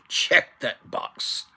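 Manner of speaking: breathy laughing check-off of his own looks; laughing aside. Acted. Vlogger laughing at his own joke about his good looks. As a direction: chuckle it out, slow and airy.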